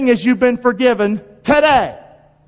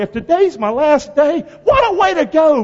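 rising preaching cry; 6 semitones higher, 3 dB louder than usual. Real, not acted. Preacher stirring the congregation, rising excited cry. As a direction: voice climbing higher and louder on what a way to go.

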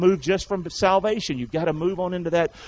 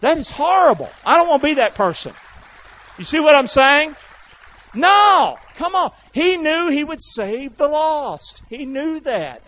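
high, loud, shouted sermon; full-force preaching; 11 semitones higher, 8 dB louder than usual. Real, not acted. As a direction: cry it out to the whole congregation.

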